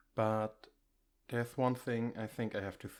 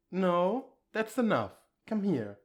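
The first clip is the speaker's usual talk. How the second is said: flustered cutoff; 7 semitones higher, 6 dB louder than usual. Acted. Cutting off flustered praise.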